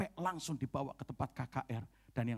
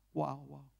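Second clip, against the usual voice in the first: normal talking voice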